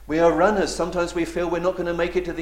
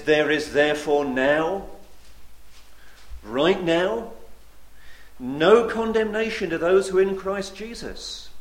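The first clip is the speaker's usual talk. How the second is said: preaching proclamation; 3 semitones higher, 3 dB louder than usual. Acted. Preacher reading Romans eight to his hearers, louder. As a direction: let each phrase land.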